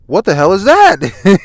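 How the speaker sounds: high, loud startled yell; read-aloud scream of disbelief. Acted. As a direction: shout it out high, stretching the words. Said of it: "He's screaming".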